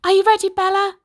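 put-on high, loud young Jack; eager boy. A narrator's reading. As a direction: call out bright and eager as a boy. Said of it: "said Jack".

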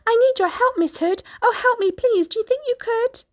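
high, urgent piggy pleading; pig crying out. A narrator's reading. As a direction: squeal for help. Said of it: "Pig cried".

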